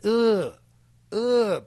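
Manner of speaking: loud, high groan of disgust. Shown as a demonstration. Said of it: "And my reaction is".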